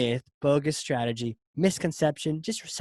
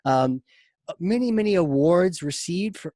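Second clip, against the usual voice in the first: ordinary talk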